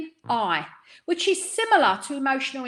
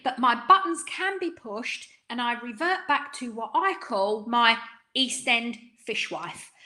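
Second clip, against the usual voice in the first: ordinary talk, nothing marked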